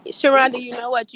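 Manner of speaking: loud, high call of her name; excited call. Real, not acted. Calling host by name.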